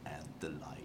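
low, hushed trailer narrator; movie trailer voice. Retold or imitated. Retelling the trailer to a friend, in a low hushed trailer voice. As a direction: intone it like an ominous movie trailer. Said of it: "the lightsaber's like".

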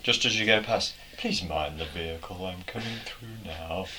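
soft, measured, polite car announcement; mock polite warning to passers-by, trailing softly. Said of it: "It's a bit polite".

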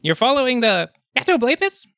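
high kobold question. Acted. Kobold questioning the trackers, high voice.

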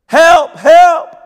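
high, loud panicked yelling; frantic cry for help. Retold or imitated. Ladies flailing in the river, shrill loud cries for help. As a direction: scream for help like you're drowning.

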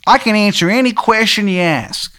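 put-on high, terrible Maryland accent; Shithead Steve's Maryland accent. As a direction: proclaim it in a hammed-up Maryland twang. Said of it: "he's got, like, a terrible Maryland accent".

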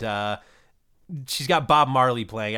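ordinary talk, nothing marked

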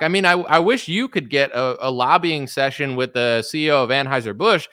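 ordinary talk

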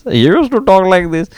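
imitating a cousin's put-on American twang; mock American accent. Teasing cousin, imitating his fake American accent. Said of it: "And he used to talk like this".

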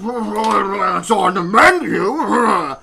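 loud, drawn-out jowly bellow; hammed-up slow roar with flapping jowls; blustering customer. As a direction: mimic him blubbering in outrage. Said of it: "He goes".

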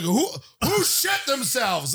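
high, drawn-out mock demand; accusing outcry. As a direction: call out the culprit in mock outrage. Retold men confronting the bar.

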